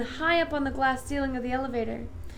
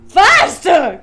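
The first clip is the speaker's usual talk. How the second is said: loud, higher urging shout; cried faster; 5 semitones higher, 17 dB louder than usual. A narrator's reading. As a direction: drive them on with a shout. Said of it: "cried Mister Wonka".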